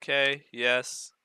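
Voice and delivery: ordinary talk, nothing marked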